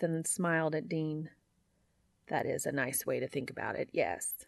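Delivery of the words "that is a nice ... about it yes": low, soft, measured reply; lightly voiced Cass. A narrator's reading. Cass answers Dean after a deep breath, low and quiet. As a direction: drop low and quiet, settling lower at the end.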